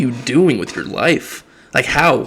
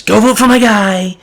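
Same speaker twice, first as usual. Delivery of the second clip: higher, louder mocking boss voice; mock Daddy voice; 4 semitones higher, 4 dB louder than usual. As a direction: lampoon a bossy leader giving orders. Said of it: "Daddy comes out and says".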